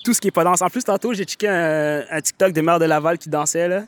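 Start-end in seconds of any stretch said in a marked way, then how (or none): none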